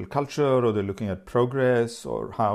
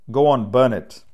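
a barked, much louder order to burn it; loud command; 10 dB louder than usual. A narrator's reading. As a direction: command the crowd, loud and sure. Headman commands toy burned.

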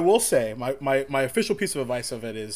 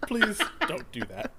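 laughing; 8 dB louder than usual. Real, not acted. Amused at the retold scene.

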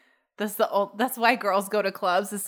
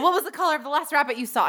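rapid quiz; 4 semitones higher, 3 dB louder, faster than usual. Retold or imitated. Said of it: "I'm like".

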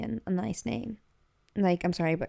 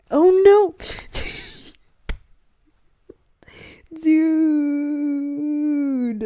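high, loud yelp then a laugh; shocked laughing outburst; startled cry; 7 semitones higher, 8 dB louder, much slower than usual. Real, not acted. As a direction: jump at the sudden slap. Did you hear the face slap?.

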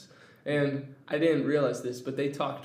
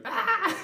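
mock wail; 3 dB louder than usual. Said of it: "sitting there crying".

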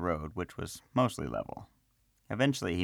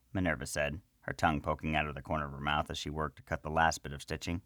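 plain reading voice

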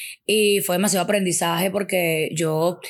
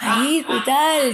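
laughing; 4 semitones higher, much slower than usual. Real, not acted. Laughing while retelling shock.

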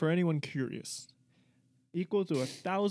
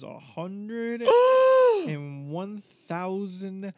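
louder, slow dramatic reveal; excited drawn-out reveal; 3 semitones higher, 7 dB louder, much slower than usual. Real, not acted. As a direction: stretch it out for drama. Announcing shocking number.

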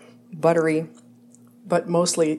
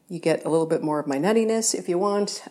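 ordinary delivery

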